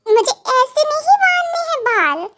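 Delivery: high, drawn-up pleading whine; childlike mild whine; whiny protest. Acted. Protests being told to braid hair.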